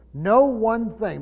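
slow "No one thing" with No stressed high and loud; emphasis. Shown as a demonstration. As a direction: stretch out "No one thing", leaning on No. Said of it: "so it's emphatic".

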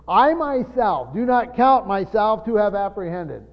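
slow, weighty stress on "I myself"; very emphatic. Retold or imitated. Preacher giving his own translation of Paul, slow and emphatic. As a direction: hit "I myself" hard, then spell out the denial slowly. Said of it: "It's very emphatic in the Greek".

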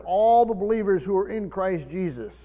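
ordinary delivery

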